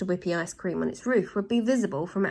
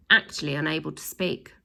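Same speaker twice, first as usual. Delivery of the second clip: usual speaking voice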